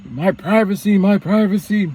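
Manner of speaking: high, whiny "my privacy" said twice; mocking dad. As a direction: mimic the father whining about his privacy. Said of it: "So now he's saying".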